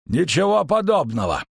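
high-pitched, cold refusal; cold innkeeper. A narrator's reading. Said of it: "проговорил холодно трактирщик".

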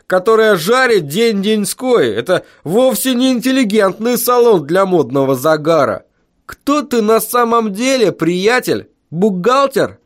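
high-pitched, incredulous foreman's banter; jovial scoffing road foreman. A narrator's reading. Road foreman laughing off a teacher's job application, scoffing.

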